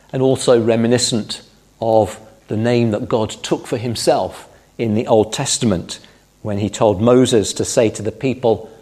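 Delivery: ordinary talk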